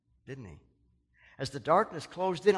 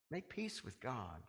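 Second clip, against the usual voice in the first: softer repeat; 2 semitones lower, 10 dB quieter than usual. Real, not acted. Preacher urging the congregation, soft slow plea. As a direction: drop to an intimate plea on Make peace with God.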